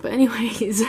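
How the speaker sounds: whispered with a little laugh; giggly whisper. Real, not acted. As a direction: breathe it out with a giggle. Laughing whisper moving to next palette.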